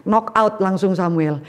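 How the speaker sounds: ordinary delivery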